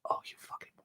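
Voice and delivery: whispered self-curse; whispered curse at his own blunder to the girl. Retold or imitated. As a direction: hiss it under your breath. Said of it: "inner reaction to his blunder".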